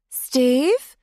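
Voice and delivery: put-on groggy and drunk, half awake; slurred sleepy call; bleary. A narrator's reading. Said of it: "she asked blearily".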